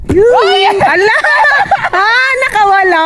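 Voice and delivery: excited shout. Real, not acted. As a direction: shout as the fish slips off the hook. Fish gets away while fishing. (sigaw sa pananabik)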